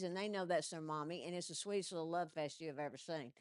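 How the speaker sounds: usual speaking voice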